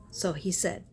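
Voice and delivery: ordinary talk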